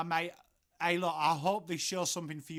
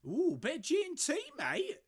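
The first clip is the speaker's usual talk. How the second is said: mock fancy voice; 11 semitones higher, 3 dB louder than usual. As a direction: relish the G and T like a pretend toff. Savouring a gin in a mock voice.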